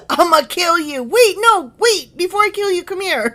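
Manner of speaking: high, loud hammed-up backtracking; mock frantic king. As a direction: play a king frantically changing his mind. Mimics the king's threat turned plea.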